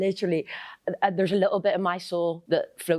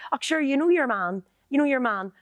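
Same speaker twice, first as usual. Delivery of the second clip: higher, Irish mammy on the phone; chatty Northern Irish mum voice, pitched up; imitating her ma; 8 semitones higher than usual. Daughter imitating her mum gossiping on the phone, chatty higher voice. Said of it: "My ma is brilliant at doing".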